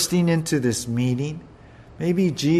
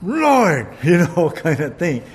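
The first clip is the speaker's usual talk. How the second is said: pitched-up indignant cry; mock exasperated complaint; 2 semitones higher, 4 dB louder than usual. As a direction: play Martha storming in to complain. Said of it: "here comes Martha".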